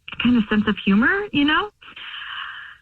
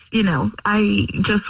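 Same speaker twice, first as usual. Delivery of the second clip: normal talking voice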